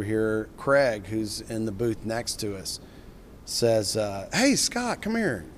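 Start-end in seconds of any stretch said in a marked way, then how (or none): 4.3-5.4 high, carrying call across the room; retold call-out. Craig calls Scott over to his table, high call-out. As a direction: imitate Craig calling him over, pitched way up.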